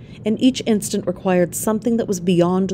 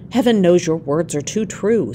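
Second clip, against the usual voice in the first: plain reading voice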